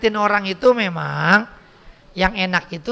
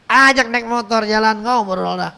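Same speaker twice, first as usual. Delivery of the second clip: ordinary talk, nothing marked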